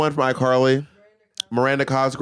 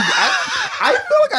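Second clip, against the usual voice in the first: laughing yelp; slower than usual. Real, not acted. Laughing outburst.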